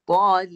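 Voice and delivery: "Tal" loud and high, vowel held into a long l; two sounds. Shown as a demonstration. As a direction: sound both parts of "Tal", lingering on the l. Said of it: "Dua bunyi".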